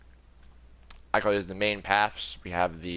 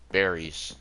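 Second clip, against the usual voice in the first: ordinary delivery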